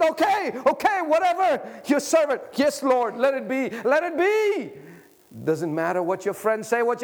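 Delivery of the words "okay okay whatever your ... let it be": high, raised yielding cry; fervent surrender. Acted. Mary's surrender voiced high.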